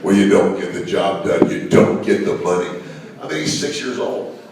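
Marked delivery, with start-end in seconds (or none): 0.0-2.7 loud, gloating taunt; heel taunt. Retold or imitated. Heel taunting the kid who missed, loud and gloating. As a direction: rub it in, loud and smug.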